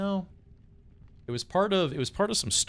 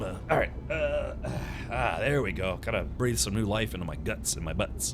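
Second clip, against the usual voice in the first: ordinary delivery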